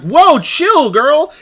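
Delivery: high, loud, swooping retort; hammed-up "calm down" reaction; mock startled protest. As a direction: imitate a taken-aback co-host. Co-host's startled retort acted out.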